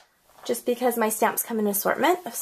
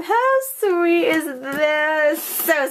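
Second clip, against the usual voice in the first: excited squeak; 5 semitones higher, much slower than usual. Real, not acted. Crafter gushing over her card, slow excited squeak. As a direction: savor each word with delight. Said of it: "When I get excited".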